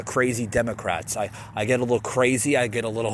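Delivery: ordinary delivery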